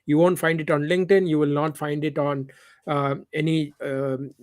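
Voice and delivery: usual speaking voice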